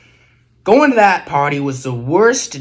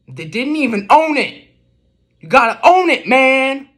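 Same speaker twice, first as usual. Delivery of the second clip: indignant scold; 8 semitones higher, 5 dB louder than usual. Acted. Scolding the unknown culprit.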